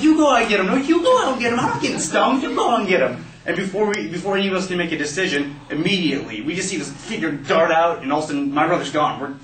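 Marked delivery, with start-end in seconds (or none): 0.0-3.3 put-on high-pitched frantic kids arguing; panicky squabbling. Kids arguing over who rescues brother, panicky. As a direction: argue back and forth, high and urgent.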